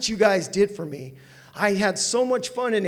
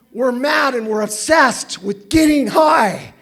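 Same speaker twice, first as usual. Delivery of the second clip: slow, loud, emphatic declaration; fervent testimony, loud and slow; impassioned shout; 5 semitones higher, 9 dB louder, slower than usual. Real, not acted. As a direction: belt it out to the room. Passionate AA share climax.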